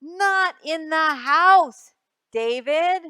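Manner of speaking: loud, slow warning; loud slow no-nonsense warning; loud scolding. Acted. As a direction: call out sharply to a child. Mother scolding in story.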